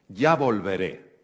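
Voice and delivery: slow, loud, clipped goodbye, imitating an annoyed patient; mock sulky farewell; annoyed patient walks out with a curt goodbye; curt annoyance. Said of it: "como bastante enfadada".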